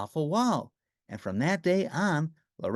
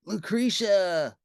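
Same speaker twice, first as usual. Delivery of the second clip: joyful cry; 5 semitones higher, much slower than usual. A narrator's reading. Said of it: "cried Lorenzo".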